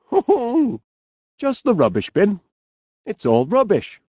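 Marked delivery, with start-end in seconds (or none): 0.0-0.8 chuckling. Acted. Laughing after the wake-up call.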